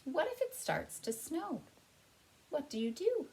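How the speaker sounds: high, lilting picture-book question; reading to children. A narrator's reading. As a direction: ask the children with high, wondering pitch.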